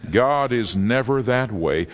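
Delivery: ordinary delivery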